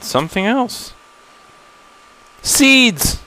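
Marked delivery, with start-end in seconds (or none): 2.4-3.2 much higher, squealed-out mock delight; a high, hammed-up cry of joy; mock excitement. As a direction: gush over the seeds, tongue in cheek. Mock excitement at finding seeds.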